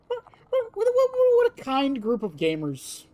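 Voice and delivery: high, loud-edged stuttering start, then falling; high exclamation. Pretend. Streamer reacting to the gamers, high exclamation.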